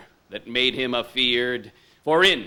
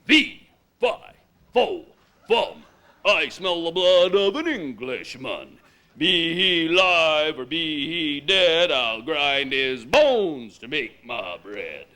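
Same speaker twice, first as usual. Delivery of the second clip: booming giant. Acted. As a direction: boom it out like a hungry giant.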